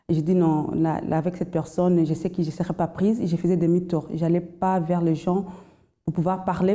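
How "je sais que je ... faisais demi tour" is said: ordinary talk, nothing marked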